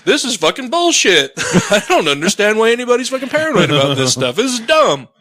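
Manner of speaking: high, laughing scoff; laughing mock skeptic. Imitating the skeptic scoffing in a house, laughing. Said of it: "he's just like".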